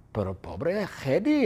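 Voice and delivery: normal talking voice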